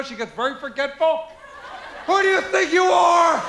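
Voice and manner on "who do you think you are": high, loud furious shout; loud shouting mother, voice strained; angry mother. Retold or imitated. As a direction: blast it like an enraged mom.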